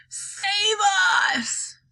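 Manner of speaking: high, drawn-out yell; high-pitched storybook wail; yelled cry for help. A narrator's reading. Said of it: "yelled Grandma Georgina".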